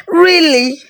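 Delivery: high, thrilled really; bright burst of delight; girl exclaiming in amazement. A narrator's reading. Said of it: "Adugo exclaimed".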